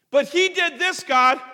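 high, loud complaining protest; whiny excuse. Retold or imitated. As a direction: point the finger and whine to God. Saul blaming others to God.

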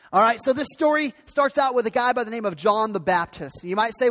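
ordinary talk, nothing marked

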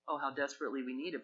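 ordinary talk

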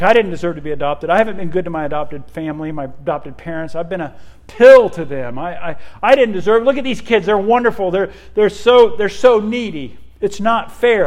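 put-on loud, fast, pleading outburst of a girl near tears; retold sobbing plea. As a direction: blurt it out fast and loud like a guilty, weeping girl. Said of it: "and she's sobbing".

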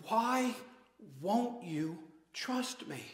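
soft, slow, aching plea; Jesus pleading softly, frustrated but loving; frustrated goodwill. Acted. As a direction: plead gently, hurt but patient. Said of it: "frustrated goodwill".

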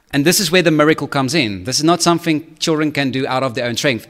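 normal talking voice

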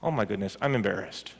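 softly, to herself, embarrassed; quiet inner voice, a little mortified; hushed aside, mildly embarrassed; inner embarrassed murmur. Retold or imitated. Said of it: "in the inside, I'm thinking".

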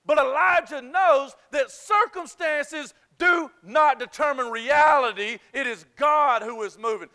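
fervent preacher's exhortation; full-force preaching. Real, not acted. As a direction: drive the point home to the congregation.

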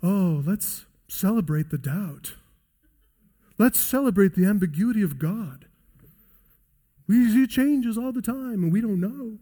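mock an airy postmodern shrug. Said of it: "This postmodern tendency to say".

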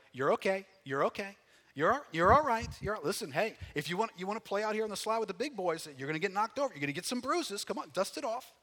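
put-on tough-love parent calling over to a fallen child; dust-it-off dad. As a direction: brush off a kid's tumble briskly. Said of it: "he's saying this along the way".

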